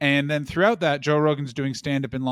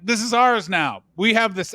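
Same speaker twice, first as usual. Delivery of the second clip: mock defiant claim; 8 semitones higher, 6 dB louder than usual. Acts drunken maniacs claiming the fort.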